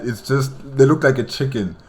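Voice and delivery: usual speaking voice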